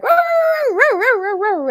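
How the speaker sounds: high, loud mock yapping of a small dog; yappy little dog sound; imitating dog barks. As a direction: bark it out like a tiny tough dog. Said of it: "She'd be like".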